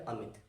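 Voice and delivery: soft, low अमित with a brief i; short i. Shown as a demonstration. Teacher shows the short matra gently, short soft i. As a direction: say अमित quietly, the i short and relaxed. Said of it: "आराम से मेरे दोस्त".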